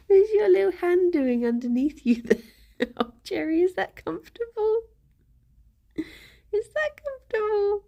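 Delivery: high-pitched coo of concern; cooing pet talk. Real, not acted. Checking if little Jerry is comfortable, cooing.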